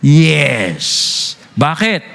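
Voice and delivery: ordinary talk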